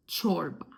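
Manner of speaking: ç as ch at the start of çorba; demonstrated ç sound. Shown as a demonstration. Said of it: "And words with ç".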